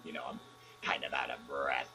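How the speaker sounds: lightly disguised caller pretending to be Luke; fake Luke Skywalker. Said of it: "this is Luke Skywalker".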